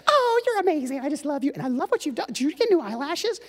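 high, loud, gushing imitation of his wife. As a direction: squeal the praise like a doting wife. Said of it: "And she's like".

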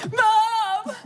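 high, crying Mom; imitated crying kids. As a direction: wail for mom like a crying child. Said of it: "they're just like".

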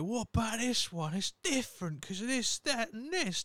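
high-pitched whingeing Cockney objector; mock Cockney. Musical fans objecting to his take, mock Cockney. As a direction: protest in a high put-on Cockney voice. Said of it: "Don't know why they're Cockney".

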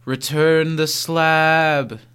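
slow, drawn-out quote; slow catchphrase. Acted. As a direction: stretch each word out slowly. Quoting catchphrase slowly.

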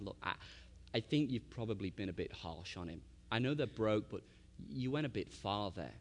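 low, soft aside to a husband; quiet reproach. Retold or imitated. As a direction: gently voice a wife's hushed reproach. Wife gently taking husband aside.

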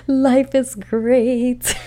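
high, slow, drawn-out bliss; giddy. Retold or imitated. Herself mid-romance, swooning slowly. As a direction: stretch it out dreamily.

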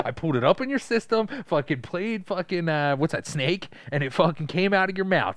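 high-pitched, hammed-up bragging; mock taunting. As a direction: taunt them as if boasting about a prank. Continues the acted taunt, riffing.

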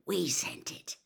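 hushed conspiratorial whisper. A narrator's reading. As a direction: let Harry in on the secret. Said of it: "Fred whispered to Harry".

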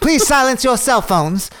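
high theater announcer voice; old-timey announcer. Pretend.

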